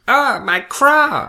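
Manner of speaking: loud, high, drawn-out bird cry; bird squawk. Pretend. Guest joins host's bird joke, squawking. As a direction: wail it slowly like a bird. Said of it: "I feel like I'm a bird".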